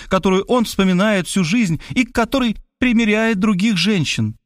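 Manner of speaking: a hint of a composed, knowing woman; cool woman detective. A narrator's reading. Detective woman coolly warning the writer.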